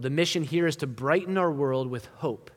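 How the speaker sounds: normal talking voice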